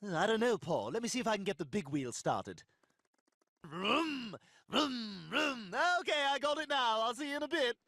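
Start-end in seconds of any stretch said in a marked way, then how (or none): 3.6-5.8 vroom vroom engine sounds; engine noise. Pretend. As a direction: play at revving an engine. Engine noise for big wheel.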